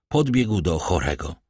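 ordinary delivery, nothing marked

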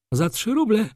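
high-pitched, sharp disbelieving question; incredulous baroness. A narrator's reading. Baroness challenges Wokulski on the price, incredulous. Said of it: "spytała baronowa, błysnąwszy oczyma jaszczurki".

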